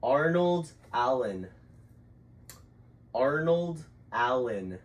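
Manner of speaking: slow, exaggerated mouthing; over-articulated. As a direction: spell it out with big lip movements. Mouthing names for lip reading.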